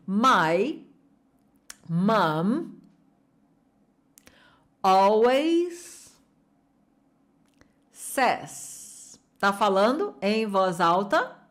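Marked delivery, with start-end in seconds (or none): 0.1-9.2 each word slow and apart. Shown as a demonstration. Teacher drilling students word by word aloud. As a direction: pause between every word, like blocks. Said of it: "Vem comigo".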